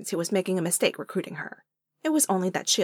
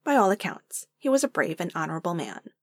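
usual speaking voice

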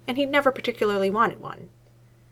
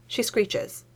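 ordinary delivery

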